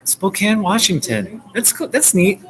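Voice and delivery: light up as you say it again; excited. Real, not acted. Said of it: "Yeah, Spokane".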